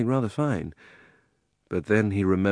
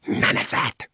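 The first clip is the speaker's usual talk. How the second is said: harsh unvoiced hiss; 4 semitones higher, slower than usual. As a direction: hiss it through the teeth. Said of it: "he hissed in Diggory's ear".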